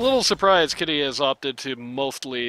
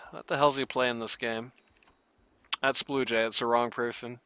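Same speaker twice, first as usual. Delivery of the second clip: ordinary talk, nothing marked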